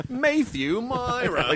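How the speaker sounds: put-on slow English accent; drawn-out British reading; English newscaster. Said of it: "You sound like a newscaster in England".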